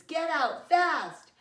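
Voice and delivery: slow, loud, high urgent shout; piercing alarm cry. Acted. Smoke alarm warning the family, piercing loud shout. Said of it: "a loud piercing sound".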